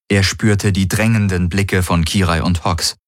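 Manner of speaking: ordinary delivery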